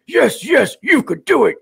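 high, far-off mock Piccolo coaching yes yes; Piccolo Funimation dub. Streamer mocking the Funimation Piccolo dub, high mock voice. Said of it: "he was like".

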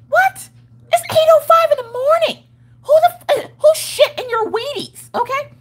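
hammed-up outraged shriek, voice cracking; mock rude receptionist. Imitating rude receptionist at 8am.

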